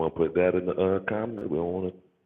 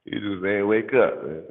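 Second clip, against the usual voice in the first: higher, louder and slow punchline; amused outburst; 6 semitones higher, 5 dB louder, slower than usual. Real, not acted. Joking about biblical drug use, loud and slow. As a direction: land the joke with a loud laugh in the voice.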